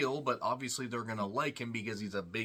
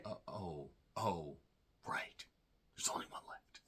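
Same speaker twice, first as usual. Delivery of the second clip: dropping to a whisper; a hushed, unvoiced afterthought; hushed aside; 5 semitones lower, 10 dB quieter than usual. Joking about the Von Erichs, then whispered.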